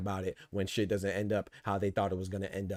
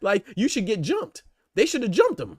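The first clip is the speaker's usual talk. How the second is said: worked-up rant; 11 semitones higher, 6 dB louder than usual. Real, not acted.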